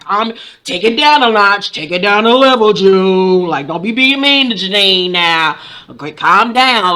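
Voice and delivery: playful scolding. Retold or imitated. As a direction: talk her down with mock concern. Mock telling June to calm down.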